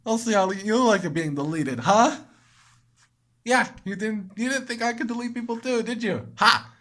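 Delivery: high, mocking jeer; mocking the deleted villain; taunting. Real, not acted. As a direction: gloat over her. Taunting Monika.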